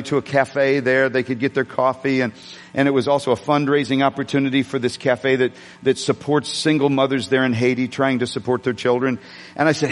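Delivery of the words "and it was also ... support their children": ordinary delivery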